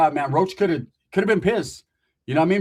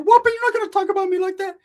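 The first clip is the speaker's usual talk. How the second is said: much higher, strained voice; indignant Ricky; 5 dB louder than usual. Acted. Ricky jumping off the car at Roach, high indignant. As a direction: act out Ricky squaring up.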